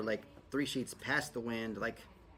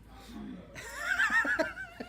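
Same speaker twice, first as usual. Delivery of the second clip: burst of laughter. Real, not acted. Loud laugh at own joke.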